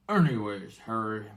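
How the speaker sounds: put-on casual giant turning to the boy; mild giant voice; a hint of giant. Giant turns back to Harry.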